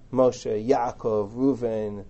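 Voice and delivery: "Moshe, Yaakov, Reuven" each stressed on the first syllable; stress shifted earlier. Shown as a demonstration. Teacher listing examples of modern Hebrew, first-syllable stress on "Moshe, Yaakov, Reuven". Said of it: "shift the emphasis one syllable earlier".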